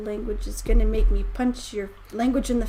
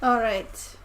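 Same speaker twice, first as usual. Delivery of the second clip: normal talking voice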